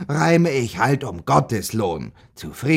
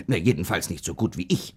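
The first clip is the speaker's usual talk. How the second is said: ordinary talk, nothing marked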